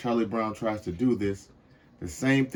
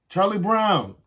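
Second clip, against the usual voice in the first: high, louder sing-song calling of his name; 9 semitones higher, 6 dB louder, slower than usual. A narrator's reading. Lucy luring Charlie Brown to the football, sing-song call. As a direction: lilt his name up high like teasing bait. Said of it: "calls Lucy in a sing-song voice".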